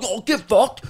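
high-pitched mock outburst; rowdy ape-like dude. Mimicking a mate hit back while horsing around, rowdy and high. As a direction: jeer back like one of the pack. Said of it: "like watching monkeys at the zoo".

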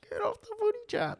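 very high, mocking listener whine; mock listener complaint. Podcast host mocking bored listeners in a high whine. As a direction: squeak it like a bored fan. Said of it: "we know you guys are like".

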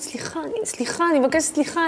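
high, repeated flustered apology; mock-panicked pleading for pardon; retold flustered apology. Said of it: "אני בכזאת פאניקה".

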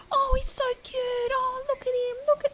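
tiny high-pitched squeal of adoration; cooing over baby. Retold or imitated. Paramedics fussing over a silent newborn, high cooing. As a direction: coo in falsetto over something adorable.

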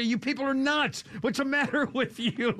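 mock exasperated Carville.

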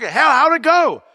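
high, drawn-out eager ask; David keen to hear the news; eager question. Retold or imitated. David eagerly asks his returning men.

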